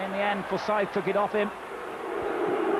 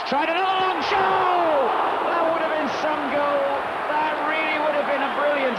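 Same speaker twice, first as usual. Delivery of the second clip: excited shout; 6 semitones higher than usual. Real, not acted. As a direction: cry out at the near miss. Long shot hits post.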